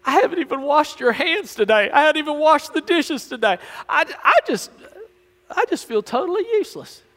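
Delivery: high, whiny mock-weepy voice; comic high-pitched blubbering; crying water faucet. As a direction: play a hammed-up faucet bemoaning its uselessness. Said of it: "seeing the water faucet crying".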